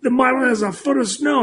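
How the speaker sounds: imitating mentor. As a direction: tell the forecast like the old mentor, raising the pitch. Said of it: "He goes".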